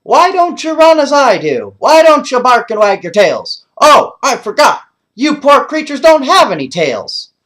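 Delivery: high, loud happy collie voice; high loud cartoon dog, teasing; excited dog. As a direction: bounce it like a dog who loves hikes. Dog Butch talks to boys.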